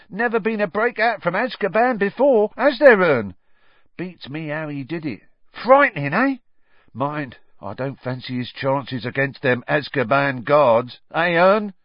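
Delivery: high, loud, excitable gossip; chatty Stan. Acted. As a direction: relish the scandal, pitched right up.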